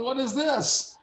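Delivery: high, squeaky comic indignation; high-pitched aggrieved retelling; mock outrage. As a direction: protest like a wronged child, hammed-up. Continues acted indignant complaint.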